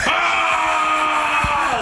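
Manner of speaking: long, high roaring yell; imitated crowd cheer. Every dude in the block cheering him, imitated roar. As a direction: cheer like a whole cell block.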